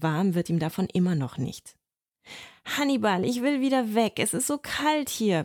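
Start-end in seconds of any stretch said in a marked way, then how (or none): 2.6-5.4 higher, pleading whine; a cold boy begging his friend. Acted. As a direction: plead to go home. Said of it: "bettelt er".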